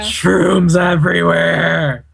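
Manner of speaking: slow, wailing playful call; drawn-out mock cry. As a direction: stretch both words out long. Mock cry about mushrooms in game.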